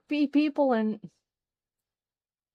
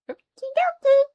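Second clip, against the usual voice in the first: high squeal; 10 dB louder, much faster than usual. Real, not acted. Cheerful sing-song okie dokie.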